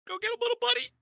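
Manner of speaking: very high cutesy voice; playful falsetto encouragement; squeaky send-off. Acted. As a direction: cheer on a little pal in a tiny high voice. Cheers the fighter on, put-on voice.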